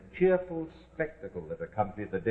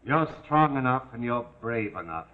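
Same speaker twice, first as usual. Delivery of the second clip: usual speaking voice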